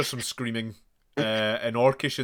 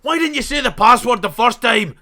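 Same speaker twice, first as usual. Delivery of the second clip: very high, loud exasperated shout; exasperated yell; 12 semitones higher, 13 dB louder than usual. Acted. Melgrim scolding the player for the late password, exasperated yell. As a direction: scold them at full volume.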